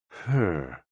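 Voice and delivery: "her" drawn out slowly on one falling breath; yawned "her". Shown as a demonstration. Narrator demonstrates the heart's healing sound, "her" drawn out like a yawn. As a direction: stretch "her" out like a mild yawn. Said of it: "with a yawn".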